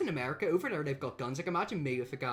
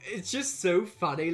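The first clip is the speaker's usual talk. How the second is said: normal talking voice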